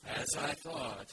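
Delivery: slow, mopey donkey voice; slow Eeyore. Acted. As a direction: drag it out like a gloomy donkey. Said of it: "you have to read it slowly".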